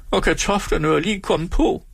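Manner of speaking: a flustered landlady wailing at the pot; flustered hostess. Acted. Frantic hostess fussing at the stove that won't boil.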